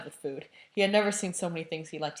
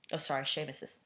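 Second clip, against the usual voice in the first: usual speaking voice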